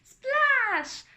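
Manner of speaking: high, loud splash cry; excited exclamation. A narrator's reading. Waves splashing Anna, shouted playfully. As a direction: burst out like a breaking wave.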